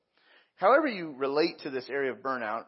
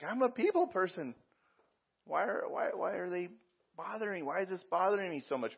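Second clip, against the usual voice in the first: higher-pitched, halting mock complaint; mildly exasperated; 5 semitones higher than usual. People person wondering why people bother them, mildly exasperated. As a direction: act baffled at being bothered, voice lifted.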